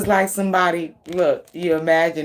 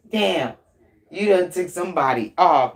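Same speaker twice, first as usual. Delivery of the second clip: ordinary talk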